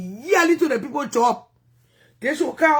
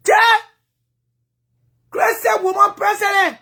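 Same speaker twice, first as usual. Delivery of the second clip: high, loud cheering shout; excited cheer; 8 semitones higher, 7 dB louder than usual. Real, not acted. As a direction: cheer it out like a rally crowd. Cheering a woman president.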